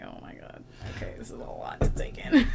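whispered overwhelm. Retold or imitated. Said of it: "I'm like".

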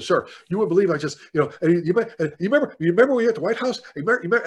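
fast, high, breathless gushing; tripping over words in excitement; excited babbling. Retold or imitated. Starstruck fan gushing to the colonel, fast and high. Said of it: "I'm doing this thing".

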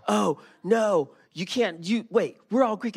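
high excited waiters' chorus; mock waiters. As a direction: play the teasing waiters, loud and high. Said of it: "they're like".

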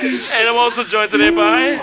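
laughing through the line; giggling while announcing. Real, not acted. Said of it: "just fucking say this shit".

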